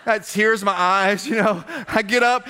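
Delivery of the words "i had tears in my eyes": ordinary talk, nothing marked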